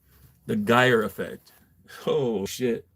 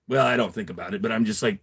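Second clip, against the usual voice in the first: ordinary talk, nothing marked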